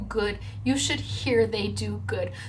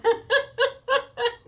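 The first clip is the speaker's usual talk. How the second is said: teary laugh; 13 semitones higher than usual. Real, not acted. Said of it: "I cry at the drop of a hat".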